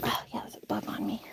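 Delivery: whispered aside; hushed, just breath, no voice. Real, not acted. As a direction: whisper an aside about the bug.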